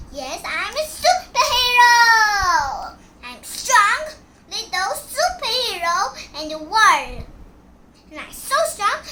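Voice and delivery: high, loud proud boast; proud shout. Pretend. Little superhero boasting of strength, loud and proud. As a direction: announce it proudly.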